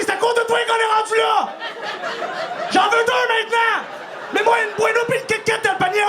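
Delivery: put-on high shouted kid demand; bratty demand. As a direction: yell like a spoiled child.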